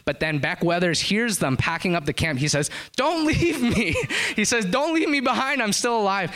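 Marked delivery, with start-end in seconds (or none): 2.9-6.3 desperate plea. Retold or imitated. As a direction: beg them not to leave you behind. Dying climber begs not be abandoned.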